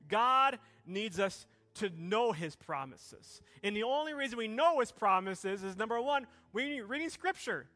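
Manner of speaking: loud, high, building sermon delivery; full-force preaching. Acted. Pastor preaching to the church, loud and rising. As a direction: preach it out over the music to the congregation.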